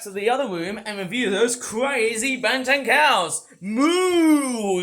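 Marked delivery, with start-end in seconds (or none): 3.6-4.8 mock cow moo. Voicing the toy cows.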